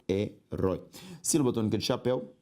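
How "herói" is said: "herói" split as he, rói, lower; syllable by syllable. Shown as a demonstration. Teacher separating the word for students, syllable by syllable. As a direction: pause inside "herói". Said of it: "se eu separo herói".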